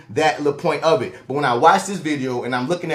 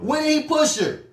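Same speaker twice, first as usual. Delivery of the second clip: incredulous question, pitched way up; 11 semitones higher than usual. Real, not acted. Host asking when he pushed her, incredulous. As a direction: demand proof in a high, disbelieving voice. Disbelief at her claim of a push.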